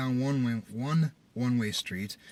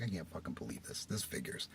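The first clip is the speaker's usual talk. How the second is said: quiet, resigned grumble; disgusted muttering; 7 semitones lower, 13 dB quieter than usual. Retold or imitated. Driver alone back in his car, disgusted muttering. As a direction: grumble under the breath to yourself.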